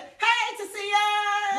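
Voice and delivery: loud happy call to a viewer. Real, not acted. Calling out to a viewer.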